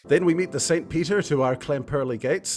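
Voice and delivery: normal talking voice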